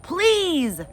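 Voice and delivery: put-on high, drawn-out toddler plea; begging toddler. Toddler Fudge begging the adman, high pleading. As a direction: beg sweetly like a small child. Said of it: "Fudge begged".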